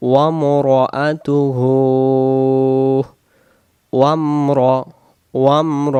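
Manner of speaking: "ওয়ামরাআতুহু" slowly as wam-ro-atu-huu; "ওয়ামরাআতুহু" with a drawn-out final huu; joined recitation. Shown as a demonstration. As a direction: join "ওয়ামর" directly, closing on m before a rounded ro. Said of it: "আমরা তো direct বলবো".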